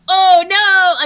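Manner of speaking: high, loud comic gasp of horror; hammed-up dismay; mock alarm. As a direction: yelp it like a backstage disaster. Understudy puts on the cat suit.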